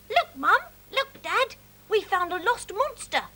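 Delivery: put-on high, eager child squeak; squeaked excitedly. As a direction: burst out with proud excitement. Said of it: "squeaked Charlie excitedly".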